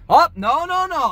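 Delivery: high, loud string of denials; emphatic denial. Retold or imitated. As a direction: imitate an adamant manager's refusal. Manager refuses over the phone.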